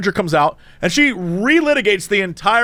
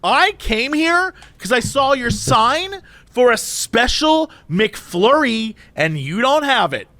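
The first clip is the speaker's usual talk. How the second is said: drawn-out, indignant lecture, pitched up; full Karen; 5 semitones higher, slower than usual. Retold or imitated. Woman lecturing cashier about wasted time, indignant. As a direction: imitate an outraged customer scolding slowly. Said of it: "she's going full Karen".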